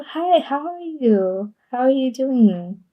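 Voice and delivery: ordinary talk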